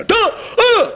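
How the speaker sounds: put-on squeaky little kid sounding out letters; high toddler voice; child voice. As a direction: play a tiny child reading aloud.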